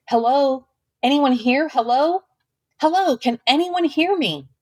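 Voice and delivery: high searching calls into emptiness; calling out. A narrator's reading. Girl calling out in the deserted town, searching. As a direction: call out for anyone, hoping.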